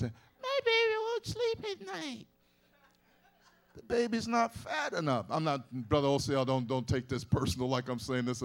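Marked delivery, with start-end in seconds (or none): 0.0-5.3 high-pitched, slow mock young mother; fretful young mother. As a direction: squeak it like a flustered new mom, half laughing.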